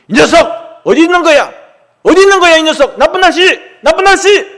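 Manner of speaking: loud, high, angry shout through the woods; father yelling. Acted. Angry father calling for his hiding son, loud shout. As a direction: bellow the boy's name like a furious dad.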